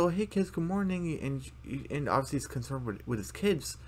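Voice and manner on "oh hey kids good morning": higher, bright good morning; cheery dad voice. Retold or imitated. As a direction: greet the kids brightly. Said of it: "And Bill's like".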